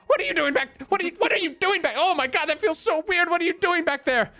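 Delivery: a confused wizard yelping at being held backwards; startled wizard. Acted. Wizard trapped in a turtle shell, high flustered yelp. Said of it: "the wizard ... is going".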